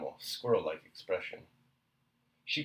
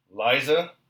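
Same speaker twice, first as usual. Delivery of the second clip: louder, patience exhausted; husband cutting his wife off, louder; dry, raised voice; 4 semitones higher, 11 dB louder than usual. A narrator's reading. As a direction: bark the name with patience gone. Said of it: "said Princess Andrew dryly, raising his voice".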